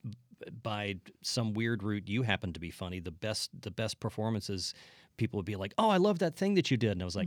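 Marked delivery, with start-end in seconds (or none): 5.8-6.9 gushing fan. Retold or imitated. Quoting fans after show.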